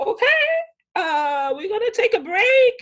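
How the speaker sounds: high, loud, awkward announcement; flustered photographer. Retold or imitated. Photographer reacts to the tears, high and flustered. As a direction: imitate someone uneasily backing off.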